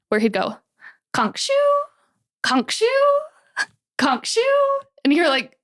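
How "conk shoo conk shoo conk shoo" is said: imitating a bad fake snore, rising; hammed-up pretend snoring. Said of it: "do the worst fake snore ever".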